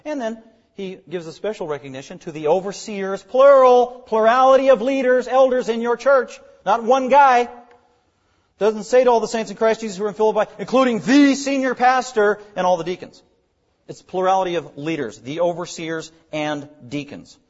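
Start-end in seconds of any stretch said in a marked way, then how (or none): 3.2-13.2 raised, louder preaching voice; emphatic preaching. Real, not acted. Preacher telling the church it needs several leaders, emphatic. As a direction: drive the point home to the congregation. Preacher drives home a point.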